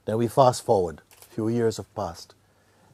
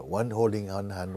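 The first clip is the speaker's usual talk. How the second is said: ordinary talk, nothing marked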